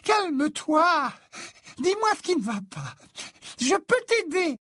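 put-on very high, lilting ghost-girl voice; singsong Mimi Géniard. A narrator's reading. Ghost girl offering help to a crying boy, singsong. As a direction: plead sweetly in a high singsong. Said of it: "dit la voix chantante de Mimi Géniard".

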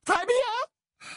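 very high, hurt and theatrical; deeply wounded. A narrator's reading. Trelawney hurt by Dumbledore's dismissal, wounded high voice. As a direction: sound deeply offended. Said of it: "d'une voix profondément blessée".

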